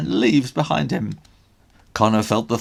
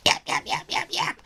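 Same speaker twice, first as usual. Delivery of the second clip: dog yapping; faster than usual. A narrator's reading.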